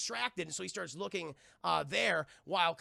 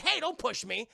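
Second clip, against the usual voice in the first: louder, higher huffy monster; indignant monster; 6 semitones higher, 6 dB louder than usual. Retold or imitated. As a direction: push back, offended. Voicing King Ghidorah.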